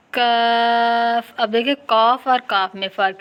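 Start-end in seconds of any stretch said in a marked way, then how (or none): 0.1-1.3 کاف with a light front k, held long; light, drawn-out کاف; thin kaf. Shown as a demonstration. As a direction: sustain کاف with a thin vowel. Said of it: "اور ایک کو باریک".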